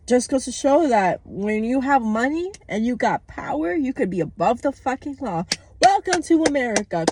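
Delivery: angry rant. Real, not acted. Ranting about the election.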